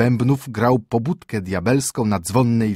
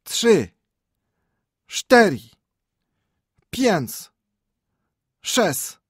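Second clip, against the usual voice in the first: much higher echoing count, falling on each number; echoes counting; 6 semitones higher, 3 dB louder, much slower than usual. A narrator's reading. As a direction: echo each number back high. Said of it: "odpowiedziały echa".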